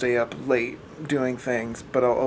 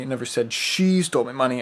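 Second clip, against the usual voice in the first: "she" leaned on, the rest fast; stress on SHE; 3 dB louder, faster than usual. Shown as a demonstration. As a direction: put all the weight on "she". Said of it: "seven different ways".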